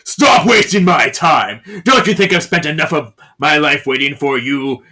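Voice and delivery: loud, acted furious shout; explosive angry reproach; enraged demand. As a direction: shout it impatiently, easing off towards the end. Enraged demand while choking him.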